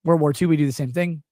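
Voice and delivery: ordinary talk, nothing marked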